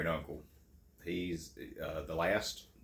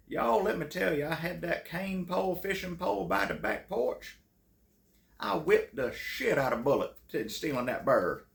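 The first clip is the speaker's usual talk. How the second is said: put-on Southern plantation-owner accent; 11 semitones higher, 4 dB louder than usual. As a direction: boast it in a thick old-Southern accent. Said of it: "sounded like he owned a plantation".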